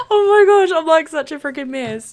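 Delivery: high whine sinking as it goes; tearful lament. Real, not acted. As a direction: wail it, close to tears. Flustered streamer bemoans being a mess.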